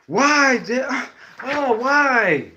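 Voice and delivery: a hint of a child's whine; whiny kid voice. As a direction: mimic a small son asking why. Son voiced in story.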